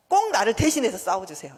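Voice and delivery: loud, earnest plea to God. Shown as a demonstration. 싸울 때 하나님께 대신 싸워달라 큰 소리로 간청. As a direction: ask God outright to fight for you. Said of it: "이렇게 말하는 거예요".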